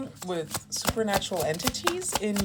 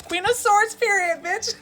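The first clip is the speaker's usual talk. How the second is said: sassy diva; 13 semitones higher, 7 dB louder than usual. Acted. Sassy channeled energy outburst.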